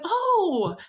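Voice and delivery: high, drawn-out "oh"; retold surprised mother. Voices her mother in dream.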